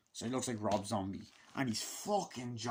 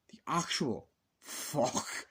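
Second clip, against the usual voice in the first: slow disbelief; 2 semitones higher, much slower than usual. Real, not acted. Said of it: "I'm like, "Dude".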